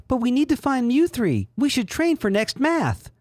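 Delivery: very high falsetto gratitude; squeaky, sugary thanks; Sarah voice. Acted.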